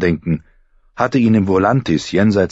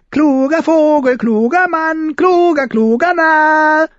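much higher, loud, crowing fool; shrill crowing fool; 4 dB louder, slower than usual. A narrator's reading. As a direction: crow it out like a mad jester. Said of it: "krähte Flickenfratz schrill".

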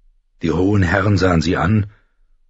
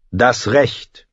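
mildly stubborn short reply, slightly higher; 4 semitones higher than usual. A narrator's reading. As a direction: hold your ground with understated firmness. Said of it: "sagte Robb stur".

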